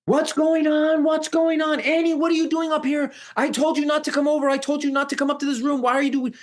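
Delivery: high-pitched, frantic imitated questioning; alarmed aunt. Aunt rushes upstairs demanding answers from Annie, acted alarm.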